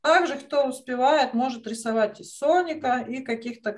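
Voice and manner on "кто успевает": ordinary delivery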